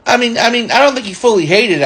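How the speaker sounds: ordinary talk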